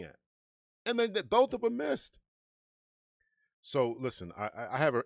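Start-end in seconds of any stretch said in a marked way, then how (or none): 0.9-2.2 much higher and louder, incredulous; exasperated outburst. Real, not acted. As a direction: blurt it out in disbelief. Indignant point about missed shots.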